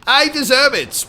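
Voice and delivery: smug put-on British accent, jumping up high; a vain host praising himself.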